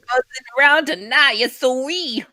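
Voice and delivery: put-on a tiny firefly with a Creole accent. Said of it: "my firefly went Creole there".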